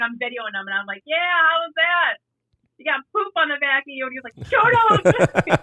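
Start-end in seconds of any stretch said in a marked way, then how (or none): 1.1-4.1 teasing. Acted. Officer filming her muddy recruit, high teasing taunt. 4.5-5.6 loud, high-pitched mock outburst; mad retort. Mad recruit to the officer filming him, loud high mock yell. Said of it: "he was so mad, dude".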